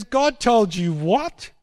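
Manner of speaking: high, slow, laughing scorn; mocking disbelief. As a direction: scoff at the idea, drawn out. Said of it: "And he goes".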